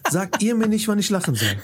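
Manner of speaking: peak of the rant, half laughing; indignant outburst. Real, not acted. Ranting about canned laughter.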